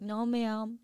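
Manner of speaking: a hint of a sweet child saying "No, ma'am"; cute little kid. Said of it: "a little kid is so cute".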